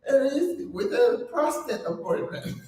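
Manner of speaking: hammed-up proud lady; mock sassy reply. Quoting the lady's post, amused mock sass. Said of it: "She's like".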